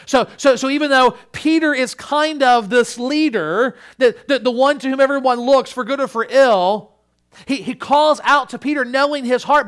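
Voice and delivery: high, loud, driving sermon delivery; forceful preaching. Real, not acted. Preacher explaining Peter the leader to congregation, forceful preaching.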